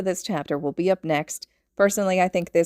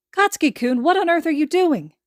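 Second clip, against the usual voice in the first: high, loud alarmed cry; 10 semitones higher, 6 dB louder than usual. Acted. Mother alarmed as the boy cuts his palm, high and shocked. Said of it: "alarming Inko".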